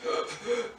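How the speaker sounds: short labored gasp; gasping breath. Shown as a demonstration. Preacher showing the dying man's struggle, gasp. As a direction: gasp for air mid-sentence. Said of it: "trying to breathe".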